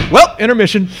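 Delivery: loud high announcement; announcing. Retold or imitated. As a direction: slap the table and announce it. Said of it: "He goes".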